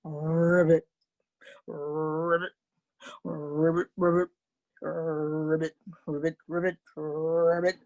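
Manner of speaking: low, rolled-r ribbits; deep smooth bullfrog call; frog croaking. Acted. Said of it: "And he went".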